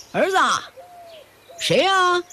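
loud question from indoors. A narrator's reading. Father asks his son who is at the door, calling out. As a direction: call from inside to ask who's there. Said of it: "有个男人大声问".

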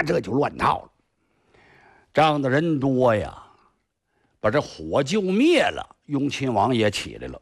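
ordinary delivery, nothing marked

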